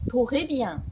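"トレビアン" with an extra O between T and R; "トレビアン" starting on a full "to" syllable; learner's mispronunciation. Shown as a demonstration. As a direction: say "トレビアン" with French R but a Japanese "to" first. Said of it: "という人が非常に多いんです".

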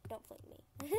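very soft, almost under the breath; quiet plea. Real, not acted. Player asking not to be flung, quiet plea. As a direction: plead softly with the game. Pleading with carrot pole.

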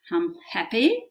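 ordinary talk

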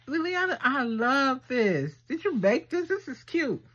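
thrilled, calling out to the friend who made it; high, delighted fangirl gush. Real, not acted. Gushing to the gift's maker.

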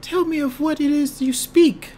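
high princess voice. Acted. Voicing the princess.

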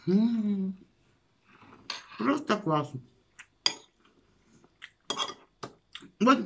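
talking between bites; savoring food while speaking; mouth full, chewing. Real, not acted. Seen 2: Bite taken at 0.1; mouth full and chewing through 2.8.

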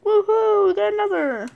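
high, loud woohoo; real delight; cheering. Real, not acted. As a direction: celebrate the lucky pull. Cheering a duplicate card.

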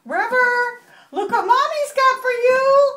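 high, loud singsong to a pet; excited pet talk. Real, not acted. Mommy showing River a new toy, excited pet talk. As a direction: build up the big surprise for a beloved pet.

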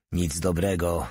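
ordinary delivery

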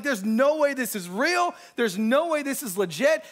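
high-pitched, insistent incredulity; offended disbelief. Retold or imitated. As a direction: re-enact refusing to believe it. Retells his offended disbelief.

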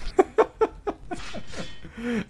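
laughing it off; high helpless laugh. Real, not acted. Laughing after Ray dies.

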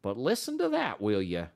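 Matt's voice, struck. A narrator's reading. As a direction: stop him and make him listen. Matt voiced by narrator.

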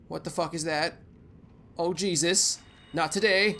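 usual speaking voice

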